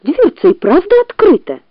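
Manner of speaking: high, amazed fox voice; surprised fox. A narrator's reading. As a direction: gasp it out, high.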